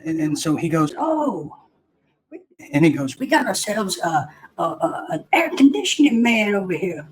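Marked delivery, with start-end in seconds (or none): 1.0-1.5 high cartoon "oh" from the petty officer; Foghorn Leghorn. Petty officer hearing the recruit's answer, high cartoon voice. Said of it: "they do sound like Foghorn Leghorn". 3.2-7.1 high, mocking cartoon officer; Foghorn Leghorn. Petty officer announcing the air conditioning man, high mocking cartoon voice. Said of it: "they do sound like Foghorn Leghorn".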